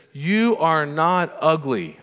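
firm, drawn-out motherly reassurance; emphatic coaching mother. Retold or imitated. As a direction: drive each word home to a hurting child. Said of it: "she's coaching him".

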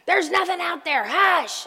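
mock yell down the hallway at a barking dog. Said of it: "And I'm yelling".

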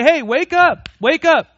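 high, loud call: hey, wake up. Retold or imitated. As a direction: rouse a dozing crowd with a shout. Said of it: "meant to say".

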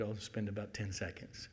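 ordinary talk

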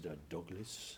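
put-on grandpa's thick Norwegian accent; slow Norwegian old man voice. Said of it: "in his thick Norwegian accent".

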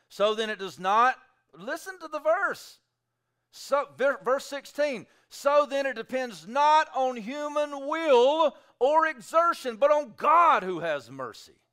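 high, loud, slow proclamation; preaching at full force. Acted. Preacher driving home verse sixteen, loud. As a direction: proclaim it over the crowd.

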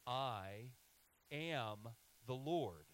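slow, weighty "I am the Lord" with a pause after "I"; said together, slowly. Shown as a demonstration. Pastor leading the congregation in unison, slow and weighty. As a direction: draw out "Lord" slowly and firmly, leading the church. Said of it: "Say it with me".

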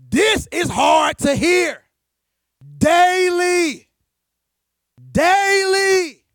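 loud, drawn-out preacher's yell; real pulpit shout, slow and loud. Real, not acted. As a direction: belt it at the congregation and at yourself. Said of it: "I'm yelling at myself too".

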